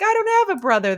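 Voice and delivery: high, loud mock objection; indignant protest. Twit objecting to the brother story, high mock-indignant protest. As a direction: protest it with the pitch jumping up.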